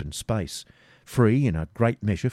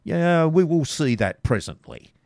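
higher, slow, sneering threat; sneering robber; 8 semitones higher, 4 dB louder than usual. Acted. Robber taunts captured Garth, higher and slow. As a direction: taunt him, drawing it out. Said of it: "said the robber".

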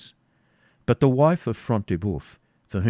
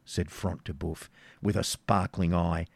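plain reading voice

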